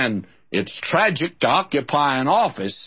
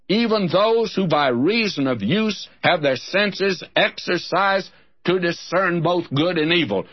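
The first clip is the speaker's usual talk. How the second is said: higher, measured Bible reading; scripture reading; 4 semitones higher than usual. Acted. Preacher reading Hebrews to his listeners.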